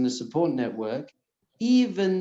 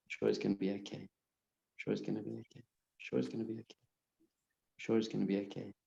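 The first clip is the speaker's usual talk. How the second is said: softer, near-whispered repetitions dying away; repeating it ever more softly; fading calming mantra; 4 semitones lower, 11 dB quieter, faster than usual. Shown as a demonstration. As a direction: let the mantra fade to almost nothing. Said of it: "Just calm your whole system down".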